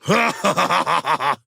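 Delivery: high gleeful creature laugh. Acted. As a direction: laugh with battle glee. Laugh after surf cry.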